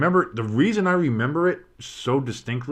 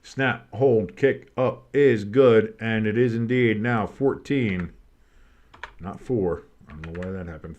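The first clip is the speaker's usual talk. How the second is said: ordinary talk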